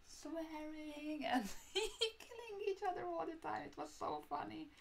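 words breaking up into high laughter; laughing. Real, not acted. Recalling a Monopoly fight with her friend for viewers, laughing high. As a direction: laugh through the memory, pitch way up.

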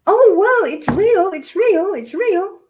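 high, loud sarcastic sing-song; scornful mimicry of media voices; mocking the media. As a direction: parody their smug belated discovery. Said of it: "people in the bloody media are just sort of saying".

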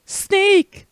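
high-pitched character calling out; excited caller. Acted. Game character hailing Snake, high excited call.

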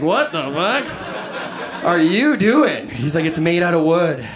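slow, dazed stoner musing with a chuckle; stoned buddy. Retold or imitated. Buddy watering the coffee table, slow dazed stoner voice.

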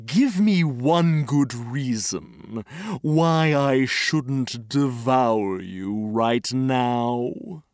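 slow, low threatening growl of a lion; menacing lion. A narrator's reading. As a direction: loom over the mouse and threaten it slowly. Lion threatens the caught mouse.

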